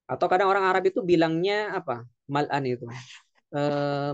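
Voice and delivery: ordinary talk, nothing marked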